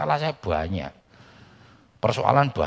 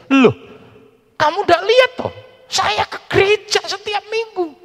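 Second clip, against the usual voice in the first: high, loud put-on old man voice; old uncle's voice; 7 dB louder than usual. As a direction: play the stubborn uncle, pitched way up. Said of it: "uncle's reply voiced in story".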